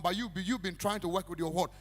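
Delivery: ordinary delivery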